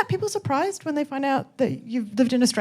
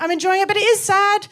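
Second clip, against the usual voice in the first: usual speaking voice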